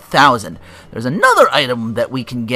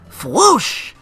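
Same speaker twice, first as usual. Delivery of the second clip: high, loud mock whoosh; voiced whoosh; 13 semitones higher, 6 dB louder than usual. Showing off the boomerang throw, imitated whoosh. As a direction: make a punchy boomerang sound effect.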